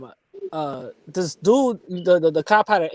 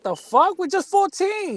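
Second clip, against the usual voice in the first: high incredulous protest; 11 semitones higher than usual. Retold or imitated. Retold own outraged reply.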